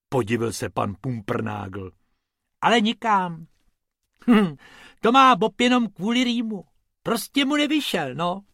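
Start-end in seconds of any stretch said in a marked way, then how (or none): 2.6-8.4 high, bright-pitched little rabbit voice; a light, explaining rabbit pitched high. Acted. Bobek explains the failed rhyme to Mr Pumpernagel, high pitched. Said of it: "vysvětlil Bobek".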